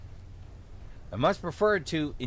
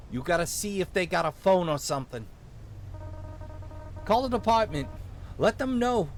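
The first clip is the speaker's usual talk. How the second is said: urgent small-town Minnesotan cop; rally backup in a light Minnesotan voice; vaguely Minnesotan accent; 4 semitones higher than usual. Acted. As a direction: Minnesotan lawman sending a kid for help. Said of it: "give him a vaguely Minnesotan accent".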